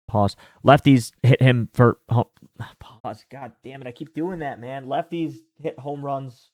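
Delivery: ordinary delivery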